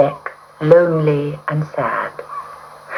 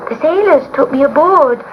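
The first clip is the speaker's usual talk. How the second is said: kitten pleading; 10 semitones higher than usual. A narrator's reading. Kitten answers witch.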